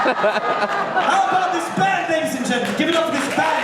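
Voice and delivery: rally the crowd for the band; hyping the band. Acted.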